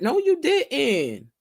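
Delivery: sassy callout. Retold or imitated. A friend calling out a friend, slow sassy no. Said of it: "you should be able to say".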